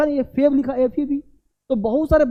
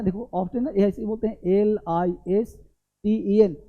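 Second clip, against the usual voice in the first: usual speaking voice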